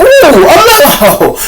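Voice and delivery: high, loud, slow mock alarm; a drawn-out hammed-up gasp of dread; retold panic. Speaker recalling the cops pulling up, mock panic. Realizing police stopped them.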